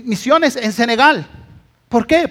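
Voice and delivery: ordinary talk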